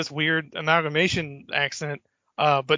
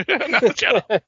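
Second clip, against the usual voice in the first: laughing, higher and louder; laughing protest; 7 semitones higher, 5 dB louder than usual. Real, not acted. Laughing at her co-host's joke, high and loud. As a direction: shove the words out through laughter.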